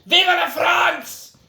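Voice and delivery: high, loud mock battle cry; big shouted slogan; rallying shout. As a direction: yell it like a revolutionary.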